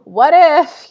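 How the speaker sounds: "What if" jumped high and loud with a laugh; bright, amused "What if" after a laugh; laughing echo. Shown as a demonstration. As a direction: throw the fear back as a laughing joke.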